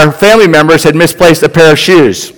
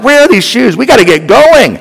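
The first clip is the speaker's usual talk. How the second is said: frustrated; 8 semitones higher than usual. Retold or imitated. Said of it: "I'm frustrated".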